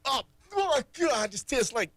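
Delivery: high, slow disgusted outcry; disgusted reaction. Retold or imitated. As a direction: act out tasting something foul. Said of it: "basically he says".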